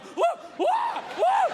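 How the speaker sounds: very high panicky whoops; mock girlish yelps. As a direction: imitate a grown man shrieking like a child. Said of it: "that noise is acceptable out of a female or".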